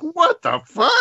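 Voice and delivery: high, incredulous mock outburst; imagined outraged son. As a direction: imitate a kid's appalled reaction. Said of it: "Andrew's gonna be like".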